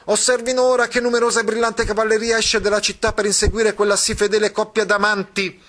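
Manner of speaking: put-on the boy announcing. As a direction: proclaim the chase to the audience. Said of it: "E il ragazzo continuò". (il ragazzo che annuncia con voce alta e squillante)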